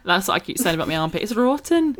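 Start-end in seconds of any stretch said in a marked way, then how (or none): 1.2-2.0 high-pitched Scottish catchphrase; Scottish accent. Retold or imitated. Quoting TikTok about her armpit, Scottish accent. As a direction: do the Scottish kid's complaint. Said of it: "in a Scottish accent".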